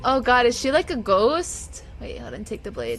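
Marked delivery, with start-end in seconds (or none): 2.0-3.0 hushed. Real, not acted. Hushed urgent aside.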